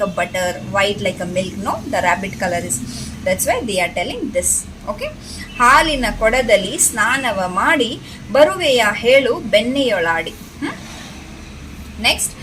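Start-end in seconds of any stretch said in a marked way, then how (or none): 5.6-10.3 lilting, measured verse reading; poem recitation. Shown as a demonstration. Teacher reads rabbit poem to children, sing-song recitation. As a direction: recite it in rising and falling waves.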